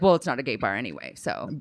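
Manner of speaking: low, fast mock shrug; dismissive brush-off. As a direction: wave it away quickly.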